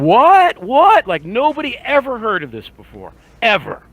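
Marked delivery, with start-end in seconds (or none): 0.0-1.1 high, loud shocked "what"; playing shocked disbelief; mock surprise. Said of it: "he starts doing this thing".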